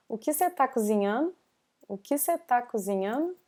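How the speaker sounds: "você tá" shortened to "cê tá"; quick casual speech, clipping "você" down to "cê"; colloquial contraction. Shown as a demonstration. Teacher showing casual speech, "você" shortened to "cê". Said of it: "Você instead of você".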